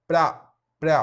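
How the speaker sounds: "Pra" hit loudly, one syllable at a time; syllable drill. Shown as a demonstration. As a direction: stress the syllable "Pra" for the class. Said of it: "Então, olha só".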